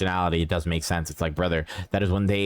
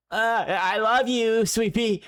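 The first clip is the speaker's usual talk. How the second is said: much higher, slow strained sweetness; forced loving mom; 13 semitones higher, much slower than usual. Retold or imitated. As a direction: force out the love through disgust. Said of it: "you're like".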